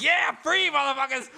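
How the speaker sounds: high, loud hammed-up victory yell; mock triumph. Parakeet finally gets out of the bars, hammed-up jubilant yell. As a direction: crow it like a freed jailbird. Acts parakeet celebrating its escape.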